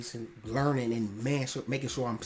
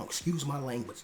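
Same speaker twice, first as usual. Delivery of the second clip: ordinary delivery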